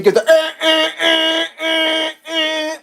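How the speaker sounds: high, loud eh eh horn blasts; imitated horn blasts. Honking again at the driver beside him, high horn blasts. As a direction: honk again with your voice. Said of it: "Give the old doot doot doot again".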